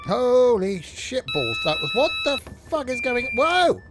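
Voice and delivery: loud, high shocked outburst; real shock at a noise; startled. Real, not acted. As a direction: yelp at the sudden noise.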